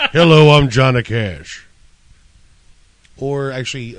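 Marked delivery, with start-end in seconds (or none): 0.1-1.7 [name] impression. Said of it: "I was just gonna do this".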